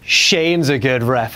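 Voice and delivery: slow, put-on voice for Bryan's line; mock Daniel Bryan voice. Mocking Daniel Bryan's announcement, slow put-on voice. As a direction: drag it out like a smug wrestler.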